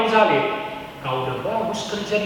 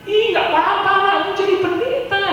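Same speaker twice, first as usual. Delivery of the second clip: high, teasing Jakarta gossip voice; gossipy onlooker; 11 semitones higher than usual. Retold or imitated. Preacher mimics a nosy Jakartan, high and teasing. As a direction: squeal it out like a nosy neighbour. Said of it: "stigma pendapat orang".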